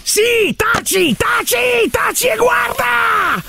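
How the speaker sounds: high, insistent shouted orders; silencing yell. Acted. Host hushing partner before launch, shouting. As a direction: shout over their protests.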